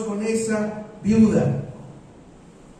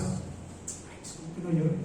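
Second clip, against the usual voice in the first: softer, breathy scoffing aside; mocking; 4 semitones lower, 10 dB quieter than usual. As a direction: sneer it quietly. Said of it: "en son de burla".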